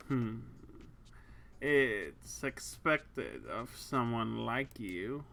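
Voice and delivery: low, slow, teasing woman; sultry lady voice. Acted.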